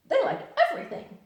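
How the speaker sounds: very high, loud and fast; cheerful Tigger. Acted. Tigger cheerfully telling Pooh about breakfast. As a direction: bounce it out gleefully. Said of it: "said Tigger cheerfully".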